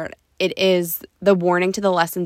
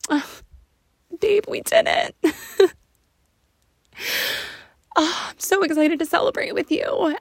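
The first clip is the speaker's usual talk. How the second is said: much higher, teary laughing joy; overwhelmed thanks to her husband; wife thanking husband, teary and laughing; emotional, laughing; 8 semitones higher than usual. Real, not acted.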